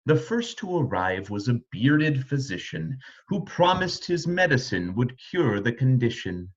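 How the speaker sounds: plain reading voice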